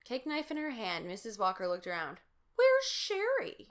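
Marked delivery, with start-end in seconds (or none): 2.6-3.7 high, loud, slow worried call; a mother suddenly missing her child; alarmed mom. A narrator's reading. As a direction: mom looking around for her daughter.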